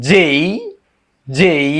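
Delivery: "যেই" as jay with a long glide to i; correct letter J. Shown as a demonstration. Teacher modelling the next letter, careful drawn-out pronunciation. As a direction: draw out the vowel in "যেই". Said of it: "এরপর হচ্ছে".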